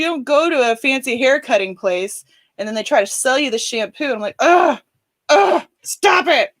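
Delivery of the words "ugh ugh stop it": loud, high-pitched whiny groan; hammed-up protest, drawn out; mock exasperation. Customer resisting the shampoo sales pitch, mock exasperation.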